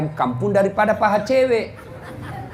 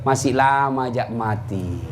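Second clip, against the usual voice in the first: low, drawn-out musing; complacent thought; 7 semitones lower, slower than usual. Retold or imitated. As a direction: voice an unhurried, putting-it-off thought, slow and low. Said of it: "Kita selalu berpikir".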